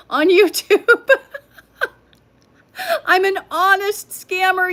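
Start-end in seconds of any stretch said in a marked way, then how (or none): 1.1-4.7 real laughter, slow and high; laughing. Real, not acted. Teasing chat about being a scammer, laughing hard. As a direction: laugh it out helplessly.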